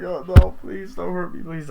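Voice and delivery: mock terrified plea; pleading fear. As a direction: beg for your life, overacted. Victim begs the killer.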